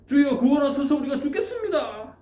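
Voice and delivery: high, loud desperate cry for rescue; terrified disciples begging for rescue, high and loud; panicked plea. Acted. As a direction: cry out as if drowning in a storm. Disciples crying out in storm.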